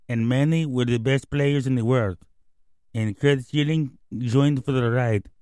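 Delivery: lightly voiced Dominican slugger, broken English; a hint of David Ortiz's Spanish-accented English. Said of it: "Thank you, David Ortiz, our special guest".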